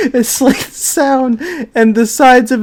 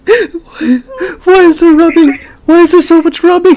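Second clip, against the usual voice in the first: high, laughing why; cracking up in disbelief; 8 semitones higher than usual. Real, not acted. Reacting to the rubbing scene, laughing in disbelief.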